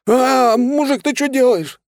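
high, loud scream of a zapped thug; pained yell. A narrator's reading. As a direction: yelp in shock, then protest at high pitch. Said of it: "заорал бедолага". (заорать от боли высоким голосом)

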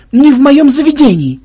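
high, loud indignant cry; roaring protest. A narrator's reading. Madam defending her establishment, roaring. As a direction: thunder it out, pitched right up. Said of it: "взревела мадам Шварценгольд".

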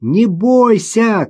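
high, stretched-out call; shouting back. A narrator's reading. Girl on the ice answers her mother, calling out. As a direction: call back slowly and high across distance. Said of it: "крикнула в ответ Маша".

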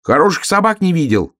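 put-on high, loud, huffy frog child; offended little frog. Little frog answers the doubting hare, offended. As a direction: protest in a hurt high voice. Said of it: "обиделся лягушонок".

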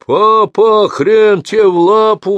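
loud, high, rowdy hail to his father; shouted greeting. Acted. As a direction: bellow it across the room at dad. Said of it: "вскричал Череп".

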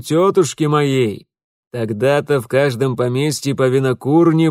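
loud, expansive, savouring each word; a jovial host showing off his cellar; hearty old landowner. Acted. Host boasting of his liqueurs.

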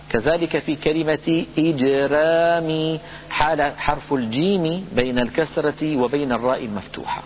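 ordinary delivery

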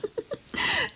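short high knowing laugh; a quick amused laugh at his expense; mocking laugh. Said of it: "Ist das so lustig?". (kurzes spöttisches Lachen)

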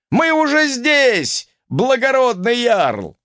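high, drawn-out call; rallying shout. A narrator's reading. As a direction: holler it to your lord, high and slow. Warriors calling out to their jarl. (протяжный крик ярлу)

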